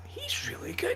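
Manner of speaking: high, hushed whisper; whispered quote. Retold or imitated. Retelling his wife's surprised verdict in a high whisper. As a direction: breathe it like a secret. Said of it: "it was like".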